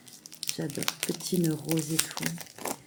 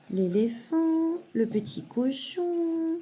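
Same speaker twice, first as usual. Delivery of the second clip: high, slow, drawn-out naming; sing-song naming; 9 semitones higher, much slower than usual. Real, not acted. Mother showing animal pictures to her child, slow sing-song. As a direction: stretch each animal's name upward for a little one.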